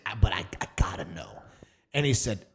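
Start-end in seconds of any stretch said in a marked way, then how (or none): 0.1-1.3 fast, voiceless whisper of urgency. Retold or imitated. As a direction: hurriedly whisper the plea. Begging guard quietly.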